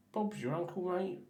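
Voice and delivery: put-on lightly done cheeky Cockney accent; a hint of a lovable Cockney ragamuffin. To the viewers, finishing the wash, a light Cockney accent. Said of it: "lovable Cockney ragamuffin".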